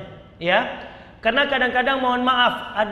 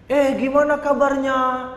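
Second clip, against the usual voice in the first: friendly greeting; 4 semitones higher than usual. Acted. Demonstrating intonation on meeting a friend, keen greeting. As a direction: greet a friend with raised, lively pitch. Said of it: "kalau ketemu sama teman".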